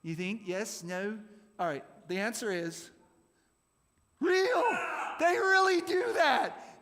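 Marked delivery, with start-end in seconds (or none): 4.2-6.8 high, loud, drawn-out announcement; triumphant shout. Acted. Quiz host revealing the answer to the group, triumphant shout. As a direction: shout the big reveal, pitched way up.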